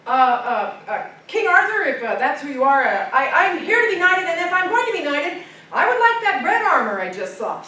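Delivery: loud, stammering, high insistence; nervous eagerness. A narrator's reading. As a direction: push on past the silence. Youth pressing the silent king.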